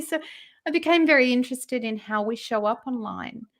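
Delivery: usual speaking voice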